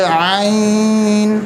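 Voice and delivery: आइन held long from the throat; long throaty आइन; lengthened letter. Shown as a demonstration. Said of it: "इसको लंबा करके पढ़ना है आपने".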